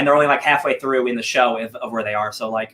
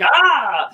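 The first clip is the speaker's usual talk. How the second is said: mock cyclops yelp; 9 semitones higher, 3 dB louder than usual. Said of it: "and Cyclops is like".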